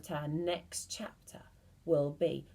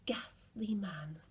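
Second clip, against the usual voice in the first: hushed whispered aside; 7 dB quieter than usual. A narrator's reading. Storyteller whispering to children about the ghastly man.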